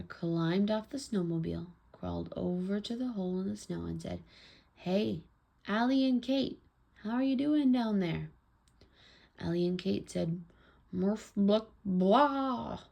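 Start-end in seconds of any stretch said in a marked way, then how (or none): 4.7-8.3 raised, louder storybook call; father hollering down, higher pitched; calling down a hole. A narrator's reading. 10.9-12.8 mock mouthful, higher and louder at the end; mouth full of snow, acted. A narrator's reading. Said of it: "their mouths were full of snow".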